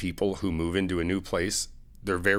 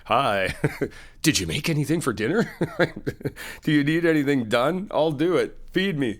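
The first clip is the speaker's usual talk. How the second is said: playful mooching; 5 semitones higher, 3 dB louder than usual. Pretend. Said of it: "Just be like".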